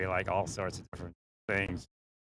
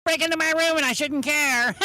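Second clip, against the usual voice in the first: mocking woman voice; 8 dB louder than usual. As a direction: sneer it out in a high screech. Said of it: "she was like".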